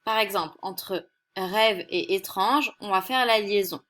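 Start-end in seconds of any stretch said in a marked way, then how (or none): none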